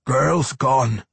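grumbling dwarf, high and loud; strained dwarf grumble; Pwent. A narrator's reading. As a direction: grumble the bad news as a dwarf. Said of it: "managed to grumble".

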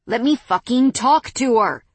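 loud, high furious yell; angry shout. Acted. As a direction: shout to be let through. Man thrashing, held back.

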